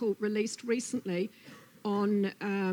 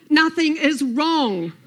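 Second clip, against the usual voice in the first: loud, high, drawn-out protest; exasperated cry; 7 semitones higher, 14 dB louder, slower than usual. Acted. Snake denies trouble to Lizard, crying out. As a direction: cry it out slowly and forcefully. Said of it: "cried Snake".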